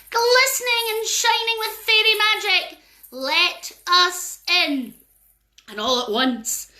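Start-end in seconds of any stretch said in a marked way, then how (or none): 0.1-4.9 higher, louder, very slow chant; fairy enchanting the table; fairy incantation. Acted. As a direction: cast the spell slowly.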